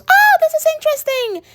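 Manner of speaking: very high, put-on voice of fascination; intrigued brain voice. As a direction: act out a brain delighted by a discovery. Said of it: "your brain goes".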